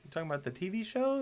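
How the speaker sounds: quick, high-pitched query; mock confused listener. Imagined listener asking about the saying, mock puzzled in a high voice.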